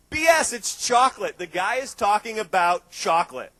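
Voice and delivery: loud, slow, scoffing; emphatic outburst. Real, not acted. To the audience, scoffing at the chocolate talk, loud and slow. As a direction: call out the nonsense to the crowd.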